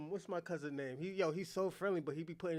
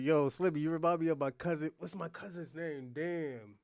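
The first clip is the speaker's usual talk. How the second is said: ordinary delivery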